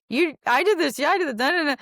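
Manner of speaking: high, loud parroting of the blame; mirroring husband. Retold or imitated. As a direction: echo her words back, playing the husband. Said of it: "he just knows to reflect me".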